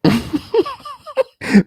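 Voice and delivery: loud, high-pitched cackle; high laugh. Real, not acted. Laughing at the live video, loud high cackle.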